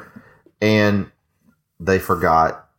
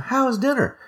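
mimicking waitress. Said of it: "the waitress goes".

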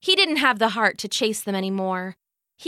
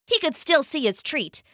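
plain reading voice

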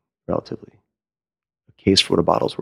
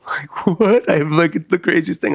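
amazed laugh; 9 semitones higher, slower than usual. Real, not acted. Laughing disbelief at own success.

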